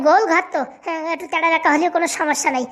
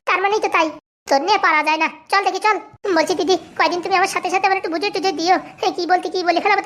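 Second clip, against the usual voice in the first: ordinary talk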